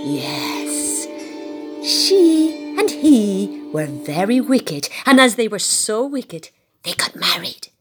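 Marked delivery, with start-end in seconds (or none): none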